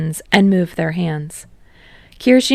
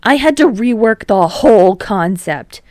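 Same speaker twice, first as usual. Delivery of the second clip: louder, exasperated protest; raised-voice grievance; indignant complaint; 3 semitones higher, 7 dB louder than usual. Acted. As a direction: vent the frustration.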